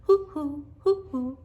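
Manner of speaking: high hooting owl call; father calling to the owl, high hoots. Acted. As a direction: hoot like a great horned owl into the woods. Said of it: "And then Pa called".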